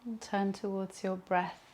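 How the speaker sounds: calm Yoga Nidra voice with long pauses; guided meditation. Acted. Leads a relaxation meditation.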